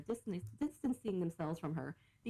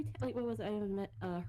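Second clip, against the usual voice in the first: normal talking voice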